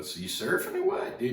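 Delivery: high, loud casual callout; upbeat. Retold or imitated. He grabs his board and calls to the kid, high and loud. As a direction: brush it off, calling out bright and high. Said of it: "I go".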